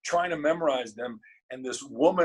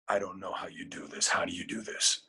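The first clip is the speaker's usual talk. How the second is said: low, hushed, half-whispered voice; meek reply; 8 semitones lower, 7 dB quieter than usual. Retold or imitated. Scared student asking the professor how to audition, meekly. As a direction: answer very softly and low, cowed.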